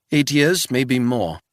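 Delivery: put-on wary old housekeeper in a thick Swiss accent; old housekeeper questioning a stranger, thick Swiss accent. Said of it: "in a thick Swiss accent".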